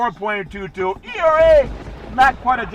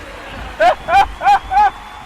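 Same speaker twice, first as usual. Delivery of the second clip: high squealing giggle; 5 dB louder than usual. Pretend. After Scarface joke.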